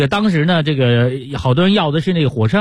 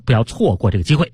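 ordinary delivery

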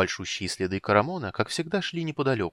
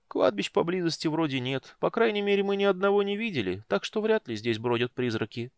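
higher, thoughtful kender; 9 semitones higher than usual. A narrator's reading. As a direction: muse it like a curious kender. Tass musing about ghosts.

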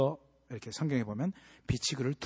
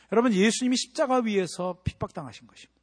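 normal talking voice

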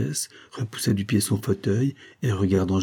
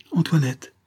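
high, alarmed call; startled, anxious call; frightened cry; 8 semitones higher than usual. A narrator's reading. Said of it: "s'écria Céline effrayée".

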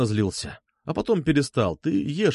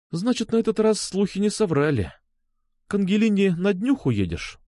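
put-on high girl's voice, mildly reproachful; suddenly gloomy; 8 semitones higher than usual. A narrator's reading. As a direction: question him with a touch of hurt suspicion. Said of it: "Она моментально помрачнела". (помрачнев, с лёгким упрёком)

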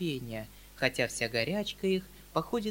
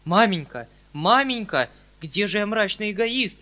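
higher, loud desperate plea; a desperate plea, higher and louder; near despair; 6 semitones higher, 10 dB louder than usual. Acted. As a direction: cry out nearly in despair. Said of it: "почти в отчаянии".

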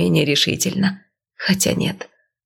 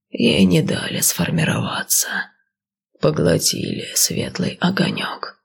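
serpent seer voice. Acted. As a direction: breathe it out like an old serpent. Snake seer prophesies.

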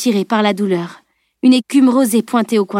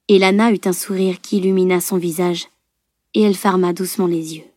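usual speaking voice

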